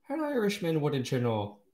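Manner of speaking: high, lilting mock Irish accent; a quick Irish accent for the quip. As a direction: toss it off in a playful Irish accent. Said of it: "in that Irish dialect".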